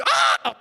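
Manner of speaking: high, loud startled shriek with a laugh; startled scream back. Retold or imitated.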